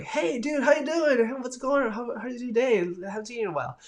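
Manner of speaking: excited hey dude greeting. Retold or imitated. Streamer greeting a returning viewer, high excited call. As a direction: hype up an old friend you haven't seen.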